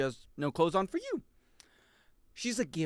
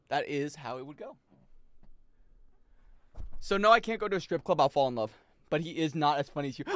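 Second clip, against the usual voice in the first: ordinary talk, nothing marked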